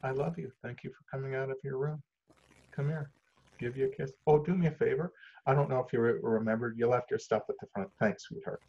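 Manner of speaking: soft, gentle, affectionate voice; tender parent. Acted. Parent greeting child out of their room, tender. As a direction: speak softly and lovingly to your child. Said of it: "and I get to say".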